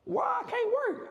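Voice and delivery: high, slowed, put-on voice of mock outrage; exasperated disbelief. Talking back to his friend in his head, high mock outrage. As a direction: squeak it out like you can't believe the nerve. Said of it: "In my head, I'm like".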